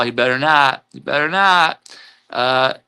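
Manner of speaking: usual speaking voice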